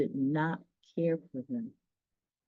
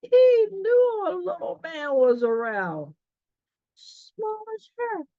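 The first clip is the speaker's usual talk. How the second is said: much higher tiny-voice narration; high little voice; 11 semitones higher, 5 dB louder than usual. A narrator's reading. Reader describing the tiny king in a small voice. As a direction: sound small and delighted.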